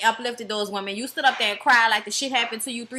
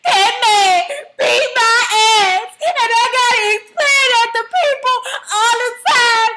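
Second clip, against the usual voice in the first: loud tearful shouting, much higher; crying shout; 9 semitones higher, 7 dB louder than usual. Retold or imitated. [name] shouting at [name], loud and crying. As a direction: yell it through tears, wounded and furious. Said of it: "she was like".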